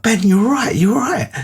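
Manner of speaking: calling down in alarm to check on him; panicked reassurance. Retold or imitated. Storyteller calls down to his fallen friend, high and alarmed.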